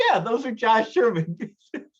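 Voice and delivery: high mock-credulous voice with a laugh; mock agreement. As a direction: play along in a high mock-believing tone. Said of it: "I would have been like".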